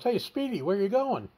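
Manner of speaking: high-pitched imitation of a camper calling over; retold calling out. Camper hailing a man running across the campground, retold high call.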